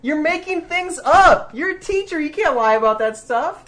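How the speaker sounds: high, slow, emphatic challenge; indignant protest. Real, not acted. Challenging co-host the teacher, high indignant protest. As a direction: draw out each example in loud disbelief. Heated mock quarrel over pronunciation.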